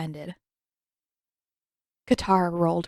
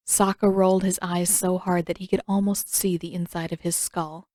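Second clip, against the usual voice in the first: ordinary delivery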